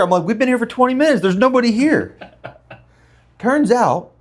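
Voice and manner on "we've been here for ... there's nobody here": high-pitched, insistent disbelief; retold incredulous protest. Storyteller insisting to the inspector the house is empty, incredulous. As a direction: protest as if it's obvious.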